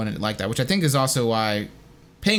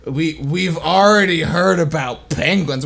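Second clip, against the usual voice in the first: labored, slow mimicked line reading; 4 semitones higher, 5 dB louder, much slower than usual. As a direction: struggle slowly through the line, a bit louder. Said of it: "he's very slow with it".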